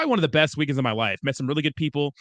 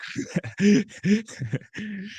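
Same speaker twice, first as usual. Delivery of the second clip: laughing; 6 semitones higher than usual. Real, not acted. Laughs at the chat message.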